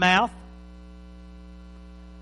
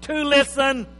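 preaching; 5 semitones higher, 9 dB louder, much slower than usual. Real, not acted. Preacher reads scripture with force.

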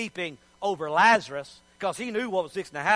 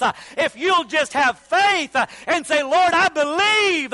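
high, driving revival preaching; rousing, shouted sermon climax; full-force preaching; 5 semitones higher, 4 dB louder than usual. Real, not acted. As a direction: preach it loud and urgent to the congregation.

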